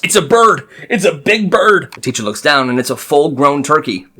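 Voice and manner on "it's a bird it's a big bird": driver yells. Retold or imitated. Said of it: "The driver yells".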